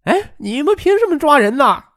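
high-pitched indignant outcry; loud protesting clamour. A narrator's reading. Young pair resisting police outside the car, clamouring loudly. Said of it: "大声嚷嚷".

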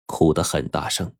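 plain reading voice

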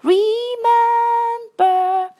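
"remember" sung high and slow with the r clear; r sound shown. Shown as a demonstration. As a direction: hold the r in "remember". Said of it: "儿在这里".

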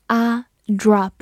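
"drop" with a wide American "ah"; open "ah" vowel. Shown as a demonstration. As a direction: sound out "啊", then "drop" with that vowel. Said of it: "字母O发成口型较大的啊". (先发“啊”，再用这个开口音读“drop”)

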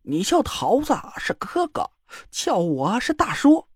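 slow, soft wheedling; smarmy. A narrator's reading. Joker sidling up to the girl: smarmy and slow. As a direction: lean in and needle her. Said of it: "贱嗖嗖的凑到李莹面前".

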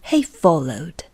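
usual speaking voice

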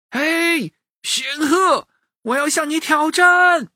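put-on high-pitched drawn boy's challenge; calling out. A narrator's reading. Boy calls up to the flying goose, challenging. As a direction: hail someone up in the sky. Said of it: "冲着大雁喊".

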